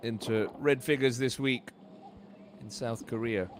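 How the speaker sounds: ordinary talk, nothing marked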